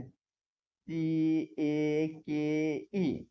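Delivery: सी ए के ई spelled out one slow letter at a time. Shown as a demonstration. Teacher showing pupils how to spell out सी ए के ई slowly, letter by letter. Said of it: "letter by letter पढ़ना है".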